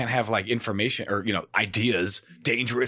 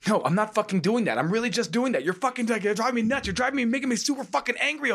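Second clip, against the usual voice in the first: higher, mock exasperated rant; mock angry rant; 10 semitones higher than usual. Comic ranting back at the marketer, higher and exasperated. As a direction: rant at the marketer, half-hammed. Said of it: "and he's like".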